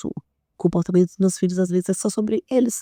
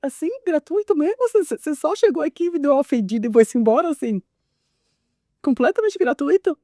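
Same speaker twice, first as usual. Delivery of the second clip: pitched-up offended mother; high mock indignation; 6 semitones higher than usual. Mother answering her son's sudden jab with mock offense. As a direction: act hurt and baffled, higher.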